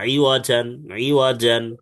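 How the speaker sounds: ইওয়া জান with a short unstretched ইওয়া; short iwa. Shown as a demonstration. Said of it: "ইওয়াতে টান হয়ে গেছে কিন্তু টানা যাবে না".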